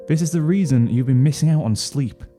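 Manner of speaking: plain reading voice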